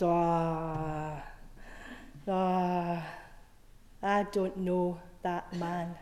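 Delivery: low, soft, drawn-out ahs of doubt; acted-out sceptic, hushed and unconvinced; doubtful reply. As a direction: back off quietly, voice sinking low.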